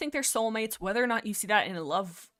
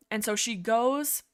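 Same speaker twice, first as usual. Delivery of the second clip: ordinary talk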